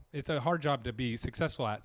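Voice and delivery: ordinary delivery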